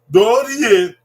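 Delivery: Dorie called out loud and falling; loud drill. Shown as a demonstration. Teacher calling Dorie out for families to repeat. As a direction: sing out Dorie so they copy it. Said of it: "Say it".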